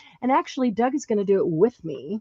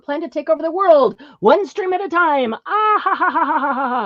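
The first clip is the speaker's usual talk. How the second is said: villain laugh; 7 semitones higher, 6 dB louder than usual. Pretend. Joke about world domination.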